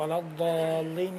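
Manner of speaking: الضالین with heavy daad, rising end; slow careful ولا الضالین; tajweed demo. Shown as a demonstration. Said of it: "قلقلہ میں کیا ملن رعایت فرمائیں".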